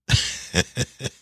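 a high giggle breaking through; can't hold back the laughter; breathy stifled laugh. Real, not acted. Laughing at the government's backing of the dollar, giggling. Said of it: "I can't get it out".

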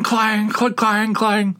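high, ringing clang clang imitation; imitating mugs clanging out of a van; mock clanging noise. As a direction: ring it out like metal mugs hitting pavement.

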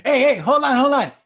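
loud, high-pitched interrupting plea; Harrison Ford bargaining. Acted. Acting Harrison Ford bargaining over freezing.

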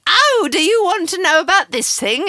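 high, loud pushy pitch; eager salesy voice. Retold or imitated. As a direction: play a tactless over-keen friend. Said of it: "They're just like".

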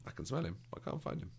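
high, hushed, half-whispered clueless tiger; mock Shere Khan, a high whispery bumbler asking after the boy; bumbling Shere Khan. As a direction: play the dim tiger sniffing about, high and breathy. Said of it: "Shere Khan is just sort of bumbling around like".

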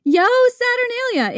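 high, drawn-out street cry; festive shout. Acted. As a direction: holler it down the street. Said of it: "People would shout to each other".

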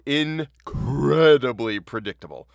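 INCREDIBLY stretched slowly for emphasis; drawn-out emphasis. Real, not acted. Host mocking the story's predictable ending, "incredibly" drawn out emphatically. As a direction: lean long and hard on "incredibly".

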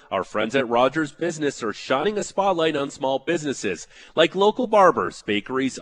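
loud, upbeat radio ad read. Acted. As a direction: sell it like a radio spot. Host reads sponsor ad.

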